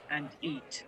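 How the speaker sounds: flat robotic automaton voice. Acted. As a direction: speak like a clockwork automaton. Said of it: "I'm gonna try and do an automaton voice".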